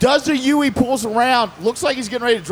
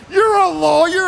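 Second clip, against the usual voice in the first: mock disbelief; 8 semitones higher, 4 dB louder than usual. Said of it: "he goes".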